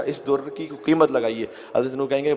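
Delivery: ordinary delivery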